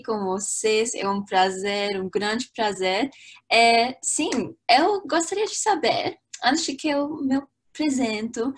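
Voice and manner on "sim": ordinary delivery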